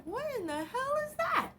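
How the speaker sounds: high, stunned question; shocked. Retold or imitated. Retelling her stunned reaction to the sky in a high voice. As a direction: squeak it out in disbelief. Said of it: "And I was just like".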